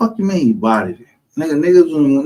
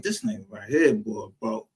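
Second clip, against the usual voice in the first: muttered disbelief; 6 semitones lower, 10 dB quieter than usual. Retold or imitated. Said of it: "I'm like".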